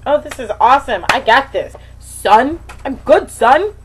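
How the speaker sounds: cocky inner voice. Retold or imitated. Mimicking her overconfident early self. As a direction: brag like a cocky dude. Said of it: "honeymoon period of".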